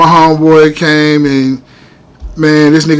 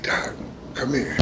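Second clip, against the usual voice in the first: much softer, low, faint call; weak call; 7 semitones lower, 19 dB quieter, slower than usual. Retold or imitated. Stabbed man calling the doctor over, faint low call. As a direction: breathe it out like you're fading. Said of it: "I was like".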